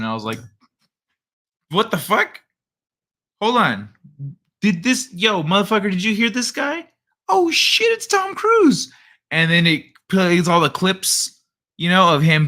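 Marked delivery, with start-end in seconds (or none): 1.7-8.9 high-pitched, disbelieving double take; reenacted shock. Streamer retelling his reaction to the end credits, mock shock. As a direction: climb from baffled to a high, astonished cry.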